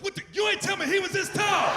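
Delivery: put-on dad seeing the giant boy at the door; shocked yell. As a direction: jump up in surprise.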